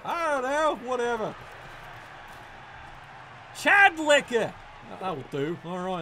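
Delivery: usual speaking voice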